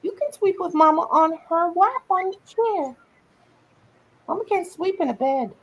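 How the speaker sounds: high, cooing pet voice. Real, not acted. Owner telling her puppy where they'll sleep in baby talk. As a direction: coo sweetly to the puppy. Talking to the dog.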